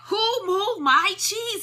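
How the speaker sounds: high, slow hollered question. A narrator's reading. Hem hollers at the empty station, high and slow. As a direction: holler it out, drawn out and outraged. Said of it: "he hollered".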